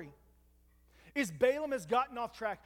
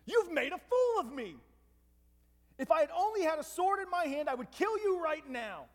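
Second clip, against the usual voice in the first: raised, higher pitched furious threat; angry Balaam; 6 semitones higher than usual. Retold or imitated. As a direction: rail at the donkey in a rage. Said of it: "Balaam is so angry".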